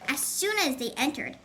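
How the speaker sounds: plain reading voice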